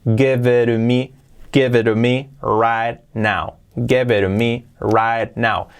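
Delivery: slow, linked "Give it to me"; slow model. Shown as a demonstration. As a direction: drill the flap slowly. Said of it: "casi no la pronuncio".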